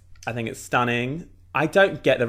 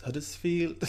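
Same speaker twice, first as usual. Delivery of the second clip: put-on Huddersfield accent; 2 semitones higher, 8 dB quieter than usual. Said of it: "I should do your Hudders".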